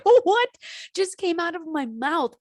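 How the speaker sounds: shocked high squeak of disbelief; startled. Retold or imitated. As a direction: gasp at your own words. Retold surprise at herself.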